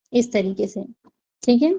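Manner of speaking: ordinary delivery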